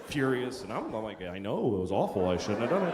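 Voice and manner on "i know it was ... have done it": low, soft, acted apology; quietly conceding fault; sheepish admission. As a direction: own up meekly. Acts out his sheepish admission.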